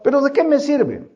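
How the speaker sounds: a hint of an exhausted student complaining; whiny young man. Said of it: "este joven me dice".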